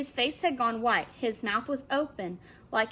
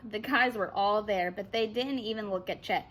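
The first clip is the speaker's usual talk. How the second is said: ordinary delivery, nothing marked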